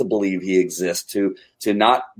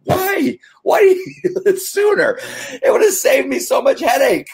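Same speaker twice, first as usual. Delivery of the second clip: high-pitched laughing complaint, much higher and louder; laughing exasperation; 3 dB louder than usual. Retold or imitated. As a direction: squeal it out through laughter, half exasperated. Exasperated inner question, laughing.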